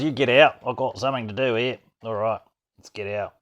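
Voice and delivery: normal talking voice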